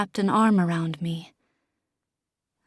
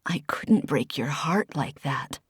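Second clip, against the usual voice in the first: whispered, lower, voice cracking; 6 semitones lower, 4 dB quieter than usual. Acted. Oliver confessing to Desi with a cracking whisper. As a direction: barely get it out, breaking. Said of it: "his voice cracking".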